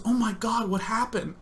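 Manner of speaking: high, hushed shock; alarmed mother. Retold or imitated. Mom hears her son is bleeding, alarmed mother. Mom's alarmed reply on phone.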